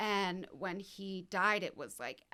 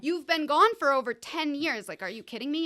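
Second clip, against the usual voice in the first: retold angry outburst, higher and louder; pissed off; 5 semitones higher, 9 dB louder than usual. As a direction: demand it like a betrayed sibling. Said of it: "I was pissed in the dream".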